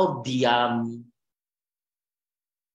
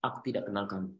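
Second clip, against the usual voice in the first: much softer, solemn voicing of God; soft grave rejection; 6 semitones lower, 10 dB quieter, much faster than usual. Preacher voicing God disowning a servant, soft and grave. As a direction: drop the voice low and heavy. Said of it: "Tuhan tiba-tiba bilang ini".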